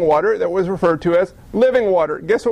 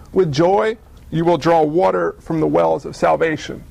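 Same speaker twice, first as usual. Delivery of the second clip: ordinary talk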